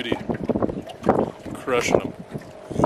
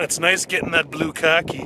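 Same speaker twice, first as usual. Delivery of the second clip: ordinary delivery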